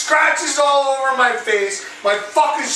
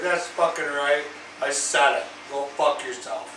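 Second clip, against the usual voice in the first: low, quiet, self-satisfied; softly acted cocky retort; smug aside; 7 semitones lower, 8 dB quieter than usual. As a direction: say it low under your breath. Smug muttered comeback after sipping tea.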